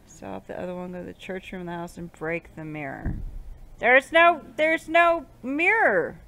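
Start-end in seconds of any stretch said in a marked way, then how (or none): 3.8-6.2 high, loud frustrated whine; exasperated protest. Real, not acted. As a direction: wail it, stretching the last word. Frustrated, mirror cannot be found.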